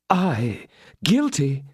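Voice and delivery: disarm him with a smile. Acted. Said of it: "with a smile which would have disarmed".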